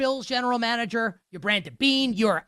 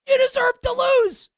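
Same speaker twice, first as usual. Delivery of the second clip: You deserve to lose, shot up much higher in disbelief; high exasperated cry; 13 semitones higher than usual. Shown as a demonstration. Host yells at the coach on TV, high exasperated cry. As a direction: wail it up high at the TV. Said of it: "repeated with rising fury".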